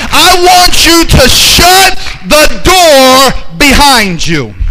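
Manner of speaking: slow, drawn-out preaching shout; preached shout. Acted. Preacher calling the congregation to shut the door, shouting slowly. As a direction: lean on every word of the command.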